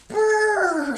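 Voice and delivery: high, loud shivery brr; shivering brr. Acted. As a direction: shiver it out, cold from outside. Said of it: "says Badger as he tromps inside".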